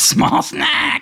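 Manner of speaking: very high, loud kobold shriek; shrieking taunt. Acted. Grey Gut screeching at Snack in a very high, loud voice. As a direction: screech the name at him. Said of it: "And he says".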